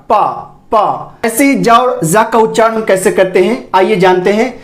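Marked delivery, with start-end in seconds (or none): 0.1-1.2 पा said alone, lips touching; demonstrated letter sound. Shown as a demonstration. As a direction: model पा twice, pressing the lips together. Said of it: "touch करवाना पड़ेगा". (पा का उच्चारण, होंठ मिलाकर)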